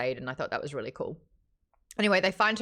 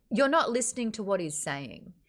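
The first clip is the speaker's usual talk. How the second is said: ordinary talk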